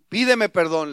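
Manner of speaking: loud, forceful demand; father raising his voice to demand an apology; firm order at full volume; stern, insistent father. Acted. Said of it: "lo ve muy serio y le dijo".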